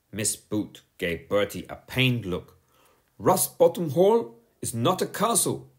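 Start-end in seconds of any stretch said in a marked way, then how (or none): 3.2-5.7 sharp, loud and impatient; snapping. Acted. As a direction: bite the words off at him. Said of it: "she snapped".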